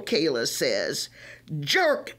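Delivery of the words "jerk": higher, mild insult; understated screech. A narrator's reading. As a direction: spit the insult, lightly voiced. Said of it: "Brandy screeches".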